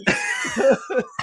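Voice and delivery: a high, bright laugh bursting out; high burst of laughter. Real, not acted. Laughs at the dad's photo.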